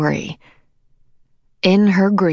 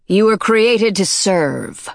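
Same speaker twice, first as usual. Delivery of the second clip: cold queen voice; 3 semitones higher, slower than usual. A narrator's reading. Queen addresses her sleepers.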